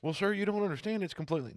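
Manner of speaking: high, pushy salesman pleading; mock a telemarketer who won't quit; a high, whiny caller pressing on. Mimicking a pushy telemarketer's reply.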